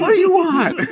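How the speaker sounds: hammed-up high, loud yell; exasperated outburst. Crashed driver snapping at the cop, hammed-up exasperation. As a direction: throw it back in mock exasperation.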